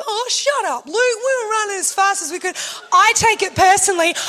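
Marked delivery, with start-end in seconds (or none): 0.1-2.6 higher, fed-up snap back; irritated retort. Retold or imitated. Friend snapping back at the husband, irritated. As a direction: shut him down. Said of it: "Joel's like".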